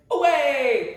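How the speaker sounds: loud, commanding call to the horse; knight's shout. Shown as a demonstration. The good knight calls to his horse, a loud shout. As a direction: bellow it like a knight spurring on. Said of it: "you have to be able to say".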